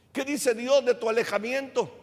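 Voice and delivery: usual speaking voice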